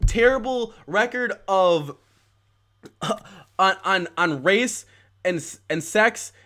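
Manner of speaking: heated rant. Real, not acted. Escalating rant about Bloomberg.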